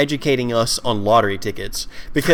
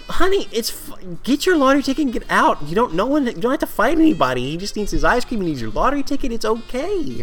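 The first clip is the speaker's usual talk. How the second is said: mock soothing; 8 semitones higher than usual.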